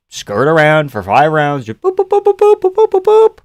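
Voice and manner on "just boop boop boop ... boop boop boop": squeaky high boops for light jabs; high boop noises. Retold or imitated. Streamer mimics a fighter skirting around, with high-pitched boops. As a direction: bounce out tiny high pings like pitter-pat jabs.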